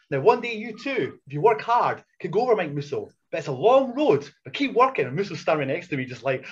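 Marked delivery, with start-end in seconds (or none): 0.1-5.0 lightly hammed-up motivational speech; half-joking lofty pep talk; mock pompous speech. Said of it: "laughing their asses off of my speech".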